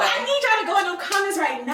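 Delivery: high, loud mock order; exaggerated demand. Shown as a demonstration. Friend demonstrating the comments line, high loud demand. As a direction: shout it high at the followers.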